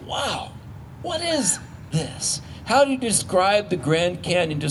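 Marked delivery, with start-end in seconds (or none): 0.1-2.4 breathy high gasp of wonder, drawn out slowly; awed wonder. Retold or imitated. Pastor acting out awe at the Grand Canyon, mock wonder. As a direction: marvel as if at the canyon rim. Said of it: "It's like".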